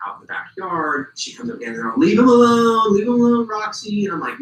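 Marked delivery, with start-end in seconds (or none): 2.0-4.1 raised, high-pitched heckling call. Retold or imitated. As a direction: imitate partygoers calling across a yard. Friends call out to Roxy.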